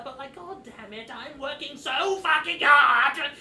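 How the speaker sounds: building to a high, very loud, drawn-out yell; exasperated goblin outburst. Acted. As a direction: blow up like an overworked goblin. Goblin's complaint bursts into a rant.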